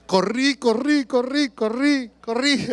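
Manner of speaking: laughing while repeating the word, pitch up; laughing run. Retold or imitated. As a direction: relive the panicked run through a laugh. Fleeing the big dog, laughing.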